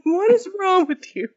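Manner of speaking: high-pitched retold disbelief; playfully exaggerated incredulous question; mock appalled. Retelling Zeke's appalled reaction to her.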